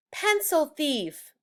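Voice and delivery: high, slow accusing taunt; accusing kid. Acted. Brad accusing Judy in class, high and taunting. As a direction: drag it out like a playground taunt.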